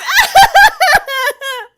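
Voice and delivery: very high, loud screaming laugh; a terrified man screaming. Acted. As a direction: let out a shrill panicked scream. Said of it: "He screams".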